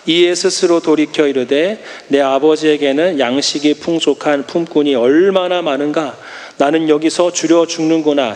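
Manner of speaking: low, measured Bible reading voice; preacher reading a verse to the congregation, low and measured; scripture reading. Real, not acted. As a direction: read the verse solemnly.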